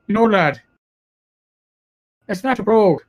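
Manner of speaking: lightly imitated Irish bartender; Irish brogue. Irish bartender teasing a cop's accent, mock Irish brogue. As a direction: correct him in a mild Irish brogue. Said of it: "I would slip into my Irish brogue".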